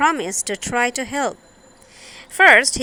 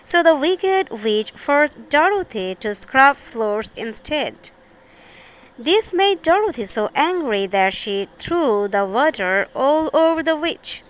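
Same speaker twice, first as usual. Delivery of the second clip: usual speaking voice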